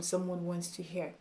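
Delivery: ordinary delivery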